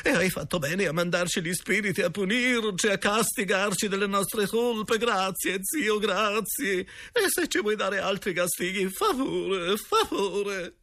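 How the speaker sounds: high, slow, wailing sobs; hammed-up tearful plea, begging forgiveness; floods of tears, stammering. A narrator's reading. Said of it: "dette in un pianto dirotto, balbettando". (pianto dirotto e balbettante)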